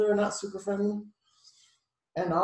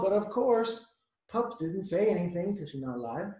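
ordinary delivery, nothing marked

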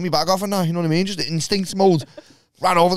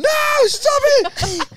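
very high, drawn-out mock scream; mock pleading shriek; much slower than usual. Woman in the tent begging the fighters to stop, mock shriek. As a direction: squeal it out as a panicked onlooker.

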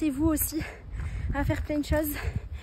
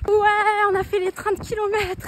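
proud cheer; 4 semitones higher than usual. Real, not acted. Reaching 30 km goal.

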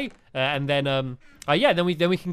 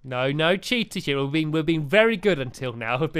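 ordinary delivery

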